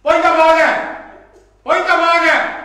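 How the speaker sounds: mock outrage crying point of order; mock heckler. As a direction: ham up a parliamentary heckler yelling point of order. Mocking opponents' heckling in parliament.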